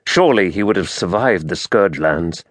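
ordinary delivery, nothing marked

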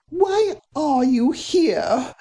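high, slow, pointed question; young woman demanding of the police, slow and high. Acted. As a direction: demand an answer, each word pressed. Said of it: "Cordelia demanded".

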